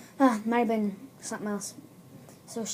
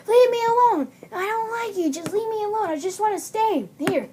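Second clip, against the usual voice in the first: very high, wailing outburst; acted protest; 11 semitones higher, 5 dB louder than usual. Frightened girl answering the caller, high acted protest. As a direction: yell high, then plead.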